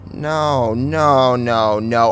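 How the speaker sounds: plain reading voice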